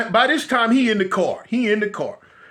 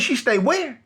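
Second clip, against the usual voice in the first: higher, incredulous question; can't believe what he heard; retold disbelief; 4 semitones higher, slower than usual. Hearing where the girl lives, higher disbelieving question.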